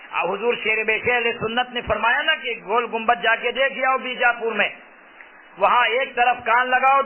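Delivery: usual speaking voice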